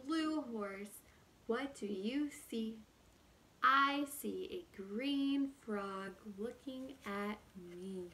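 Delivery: ordinary delivery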